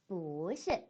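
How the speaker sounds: 뿌시 with 뿌 rising from low, then 시 falling; second-tone 뿌. Shown as a demonstration. Teacher models the corrected 뿌시 for students, rising 뿌. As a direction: say 뿌시 with a drawn-out rising tone on 뿌. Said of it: "뿌시라고 발음해 주셔야 됩니다".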